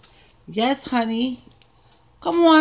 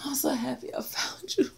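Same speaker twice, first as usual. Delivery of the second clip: soft whisper of joy; 12 dB quieter than usual. Real, not acted. Crafter whispering to her treasured pad.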